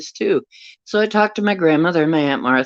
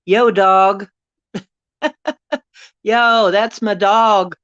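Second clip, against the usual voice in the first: playful greeting; 7 semitones higher, 4 dB louder, slower than usual. Real, not acted. Greeting viewer, laughing.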